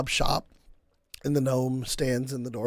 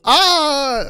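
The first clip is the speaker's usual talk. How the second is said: Volo exclamation; 12 semitones higher, 10 dB louder than usual. Acted. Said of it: "I can't do my Volo accent right now".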